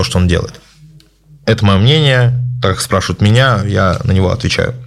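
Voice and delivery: ordinary talk, nothing marked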